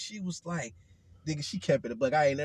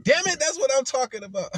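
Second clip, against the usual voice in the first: excited teacher; 10 semitones higher, 9 dB louder than usual. Retold or imitated. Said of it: "She was like".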